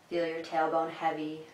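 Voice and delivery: even, calm cues with long silences; unhurried meditation guide, pausing after each instruction; guided meditation. Acted. Guides breathing and relaxing.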